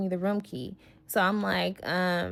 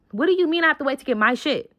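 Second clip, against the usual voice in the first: faster, sharper repeat of the question; retold outburst; 5 semitones higher, 4 dB louder, faster than usual. Snapping at the dude over her luggage, fast angry repeat. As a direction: fire the question back, faster and hotter. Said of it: "repeated louder and faster".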